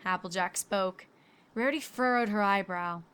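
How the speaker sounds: ordinary delivery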